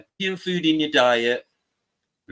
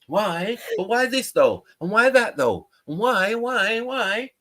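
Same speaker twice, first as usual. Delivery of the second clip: higher, pestering whys; imitating Sash; 7 semitones higher, slower than usual. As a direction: nag why over and over like a child. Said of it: "Sash just asks why".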